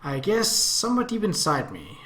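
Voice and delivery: usual speaking voice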